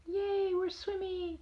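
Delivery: high, soft, slow cheer; happy dog voice. Acted. Grandma voicing swimming dogs, high and soft. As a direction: squeal softly like a happy dog.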